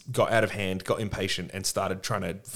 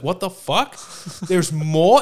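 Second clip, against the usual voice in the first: higher and louder, a mock outraged yell; Doomslayer outraged; 8 semitones higher, 7 dB louder, slower than usual. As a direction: play the fed-up hero who finds more enemies. Retelling the Doomslayer's outburst.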